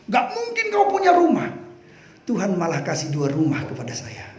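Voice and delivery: high, jeering taunts; mocking taunts. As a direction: sneer at the preacher's choice. Mocking doubters' voice.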